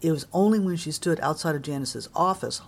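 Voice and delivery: usual speaking voice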